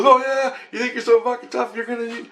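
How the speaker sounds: high-pitched goading imitation; mocking challenge. Man facing a shotgun, mocking challenge. Retelling a man's defiant challenge.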